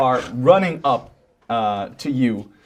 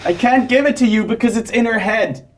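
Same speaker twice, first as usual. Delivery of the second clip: higher, halting, scared admission; high frightened confession; 9 semitones higher than usual. Acted. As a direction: stammer out the secret, scared. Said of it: "He said".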